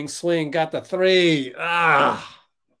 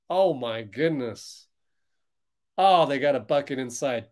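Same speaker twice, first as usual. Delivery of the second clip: usual speaking voice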